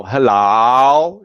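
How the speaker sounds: HELLO with the last vowel drawn out long, rising and loud. Shown as a demonstration. Showing learners long vowels, HELLO stretched slowly. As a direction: stretch the end of HELLO, keep it going. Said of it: "they have like, the long vowels".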